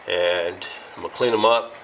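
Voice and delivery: ordinary talk, nothing marked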